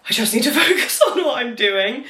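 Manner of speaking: high laughing self-talk. Retold or imitated. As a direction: laugh through the words.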